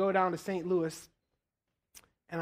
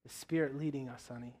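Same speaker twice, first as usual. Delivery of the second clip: soft plea; 5 semitones lower, 7 dB quieter than usual. Retold or imitated. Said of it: "And I was like".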